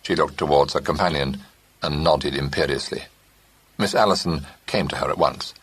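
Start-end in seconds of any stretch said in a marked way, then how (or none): none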